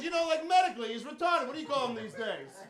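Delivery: clumsy cop trying to explain; awkward agent. Retold or imitated. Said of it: "He goes".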